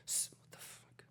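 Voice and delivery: whispered curse. Real, not acted. Whispered curse, baby sleeping.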